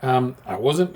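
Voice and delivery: usual speaking voice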